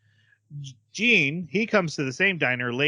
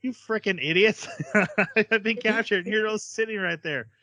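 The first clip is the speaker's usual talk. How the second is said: put-on higher, annoyed girl voice, laughing through it; exasperated Fu; 8 semitones higher than usual. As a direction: scold the boys in disbelief. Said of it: "Fu's like".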